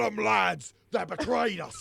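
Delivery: loud, high rallying troll roar; troll boss shouting to his gang; troll battle cry. Acted. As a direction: rouse the lads to fight. Troll rallying others to attack.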